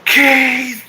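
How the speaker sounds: high, loud holler of a name; drunken-style yell. Shown as a demonstration. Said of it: "yelling at the top of my lungs like a drunk".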